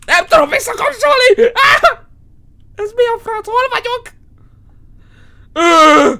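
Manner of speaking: real fright bursting out as a yelp; panicked scream. Real, not acted. Gamer panicking at the radio he can't turn off, shrieking.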